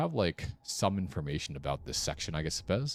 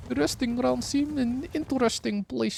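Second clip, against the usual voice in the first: much higher, put-on Eastern European accent; vague Eastern European accent; 8 semitones higher than usual. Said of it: "practicing vaguely Eastern European accent".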